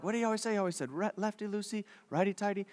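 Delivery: quiet, half-remembered muttering; muttered recollection. Acted. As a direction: mumble it to yourself while trying to remember. Muttered recalling of dad's tip.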